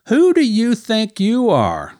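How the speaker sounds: high, slow sneering taunt; mocking enemy voice. Preacher voicing the enemy's lie to the congregation, sneering.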